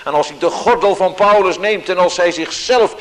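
loud, high, driving preaching; vurig preken. Acted. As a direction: build up the urgency of Paul's journey. Preaching with rising force. (luid en gedreven preken)

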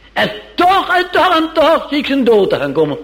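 high, strained foreboding; Pilate foreboding. Acted. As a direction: cry out a dark premonition. (Pilatus hoog en gespannen vol voorgevoel)

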